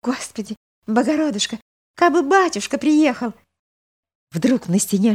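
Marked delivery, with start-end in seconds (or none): 0.0-3.3 eager prayer. Acted. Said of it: "Глаза её горели, губы дрожали".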